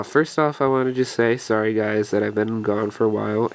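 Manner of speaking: usual speaking voice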